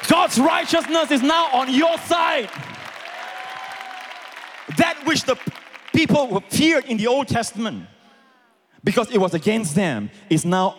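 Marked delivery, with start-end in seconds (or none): 0.1-7.9 high, ringing proclamation; triumphant preaching. Real, not acted. Pastor telling believers righteousness is theirs, high exultant preaching. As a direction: proclaim it to the whole congregation.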